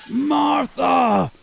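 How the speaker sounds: high, slow ghostly shriek. Acted. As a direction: scream it like an outraged spirit. Ghost reacting to its name.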